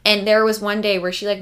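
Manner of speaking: usual speaking voice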